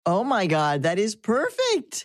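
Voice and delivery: high, drawn-out thrilled reaction; delighted amazement. Real, not acted. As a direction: let the pitch soar with delight, stretching the words.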